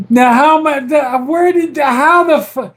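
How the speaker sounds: real stunned sputtering; flustered disbelief. Real, not acted. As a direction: sputter in shock at the prank. Flustered by the prank reveal.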